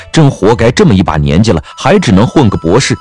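ordinary talk

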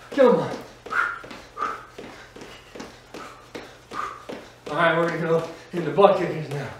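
loud upbeat workout coaching; aerobics instructor. Acted. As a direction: pump up the class, energetic. Fitness instructor pumping up class.

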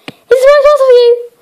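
fast, high gabble; rushed delivery. Acted. Mister Rush handing over the parcel, fast and high. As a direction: rattle it off in a hurry.

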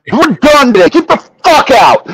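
high-pitched furious dismissal; retold apeshit rage. Retelling how he ordered the crew out, high and furious. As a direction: throw them out in re-enacted rage, pitch up. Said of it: "I was going apeshit".

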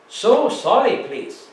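a mild mocking Japanese accent, sorry pitched high; lightly imitating a Japanese accent, sorry pitched high. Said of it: "mocked the Japanese accent".

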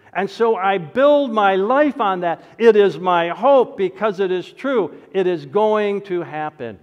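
full-force preaching. Acted. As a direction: preach it out with conviction. Preaching climax on hope.

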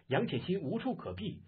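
plain reading voice

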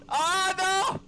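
sudden high cry of dismay; panicking gamer. Real, not acted. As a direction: yelp and wail at dying. Dies in game, cries out.

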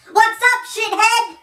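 normal talking voice

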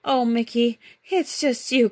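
a hint of a girlish sigh of relief; relieved Minnie. A narrator's reading. Minnie relieved to see Mickey, lightly voiced relief. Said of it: "cried Minnie".

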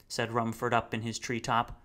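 plain reading voice

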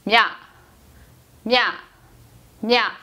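냐 said as "mya", each one separate; pronunciation of 냐. Shown as a demonstration. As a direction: model 냐 as m gliding into ya, pausing between repeats. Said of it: "이렇게 읽는 거죠". (냐를 '먀'로 한 번씩 끊어서 발음)

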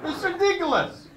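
loud, high shouted disbelief with laughs; mock outrage at the TV. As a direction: rant at the television in fake fury. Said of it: "I really shouted at my television".

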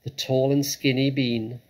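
plain reading voice

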